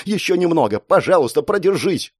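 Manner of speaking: high, desperate pleading; urgent cry. Acted. Girl begs Mark to hang on, crying out. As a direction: call out, begging him to last. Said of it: "крикнула она мне".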